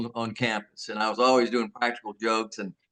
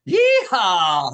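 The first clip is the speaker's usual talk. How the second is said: high, loud cowboy holler; loud country whoop; yeehaw whoop; 6 semitones higher, 7 dB louder than usual. Acted. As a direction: let out a rebel yell. Cowboy whoop after song.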